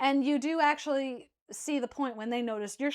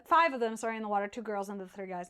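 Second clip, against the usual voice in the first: normal talking voice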